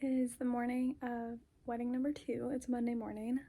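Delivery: hushed early morning whisper. Real, not acted. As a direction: whisper so nobody wakes. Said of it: "I'm in the closet whispering".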